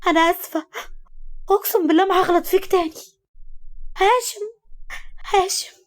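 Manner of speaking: high-pitched frantic pleading; tearful cry. A narrator's reading. Woman begging Hashem to back off, tearfully crying out. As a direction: cry out while holding back tears. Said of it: "صرخت فيه وهي بتحاول كتم دموعها".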